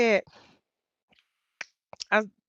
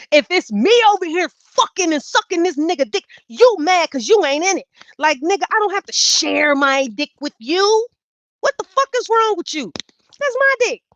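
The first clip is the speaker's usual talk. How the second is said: higher, louder furious tirade; ranting at the roommate about jealousy; heated rant; 7 semitones higher, 5 dB louder than usual. Retold or imitated. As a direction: go off on her.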